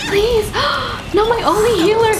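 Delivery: high, rushed pleading; frantic high-pitched alarm; panicked. Real, not acted. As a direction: yelp frantically at the game. Healer in danger.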